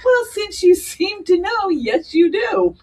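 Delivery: high, slow, sing-song mock of the radiologist; snooty radiologist. Radiologist confirming the diagnosis to the patient, mock-sweet and smug. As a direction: drawl it out high and smug, mocking her. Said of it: "she said to me".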